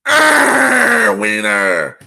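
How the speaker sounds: mock-furious Wolverine growl; angry growl. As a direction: growl it like an enraged Wolverine biting down. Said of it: "It's, like, angry. He's like".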